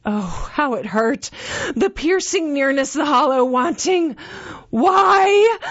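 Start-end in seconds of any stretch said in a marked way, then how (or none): 4.7-5.6 loud, high wailing why; anguished cry. Acted. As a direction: howl it in agony. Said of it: "I moaned".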